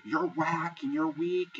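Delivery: imitating Lauren telling her off, high-pitched; mock screaming rant. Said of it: "standing on this bench screaming at Alex's head".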